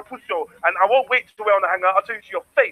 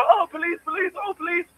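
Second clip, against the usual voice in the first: high-pitched mocking cry for the police; mock panicked call; 7 semitones higher, 4 dB quieter than usual. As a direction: imitate a scared snitch calling for help. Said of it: "you're calling feds over".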